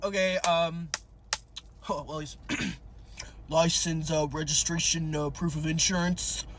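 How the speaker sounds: low traffic cop patter; cop impression. Officer asks driver for documents, cop impression. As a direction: list it off like a patrol officer, low. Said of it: "my cop impression".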